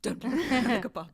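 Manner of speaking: high, loud mock warning; mock panicked warning. Guest jokes about the no-eye-contact rule, loud mock warning. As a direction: blurt it as a playful urgent order.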